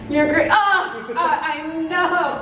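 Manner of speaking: ordinary talk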